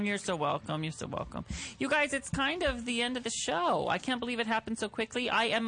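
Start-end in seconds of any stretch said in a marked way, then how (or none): 0.8-1.5 soft breathy whisper. Real, not acted. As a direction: drop to a whisper. Repeated thanks, second whispered.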